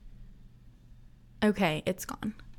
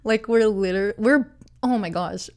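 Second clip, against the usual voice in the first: laughing; 3 dB louder than usual. Real, not acted. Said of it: "we are laughing".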